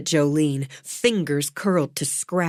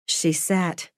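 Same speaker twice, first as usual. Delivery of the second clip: usual speaking voice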